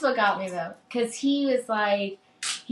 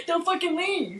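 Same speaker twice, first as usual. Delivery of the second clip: pleading outburst; 9 semitones higher than usual. Retold or imitated. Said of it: "I was like".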